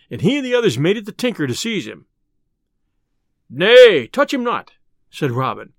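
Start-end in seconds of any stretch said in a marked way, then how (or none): 3.5-4.7 loud first word, then high; urgent command. Acted. Robin holds his men back, loud urgent command. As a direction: stop them with a loud cry.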